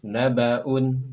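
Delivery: "Nabaun" loud and clear; model Arabic reading. Shown as a demonstration. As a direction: sound out "Nabaun" for copying. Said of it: "Berikutnya silakan". (contoh bacaan "Nabaun")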